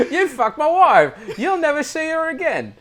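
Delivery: loud, high mock-furious English accent; over-the-top English accent, half laughing; exaggerated Englishman. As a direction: shout it as a wronged English husband, hammed up. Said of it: "sounds like an actual Englishman".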